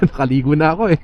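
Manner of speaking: fast, laughing through the words. Real, not acted. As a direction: giggle as you say it. Laughing over finally swimming.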